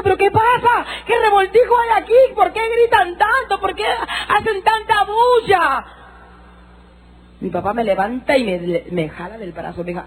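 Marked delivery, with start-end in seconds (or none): 0.0-5.8 high, slow, crying shout; frantic shouting. Retold or imitated. Woman on the church floor shouting at the noise around her. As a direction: shout it out, bewildered and overwhelmed. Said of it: "caí al piso y decía".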